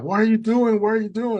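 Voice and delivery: high, loud alarmed yell; retold screaming. Boatman screaming at a swimmer on his rope, mock alarmed yell. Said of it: "the guy starts screaming".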